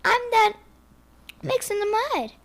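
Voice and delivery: the pig brags about the mud in a high squeal. Acted. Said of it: "said the pig".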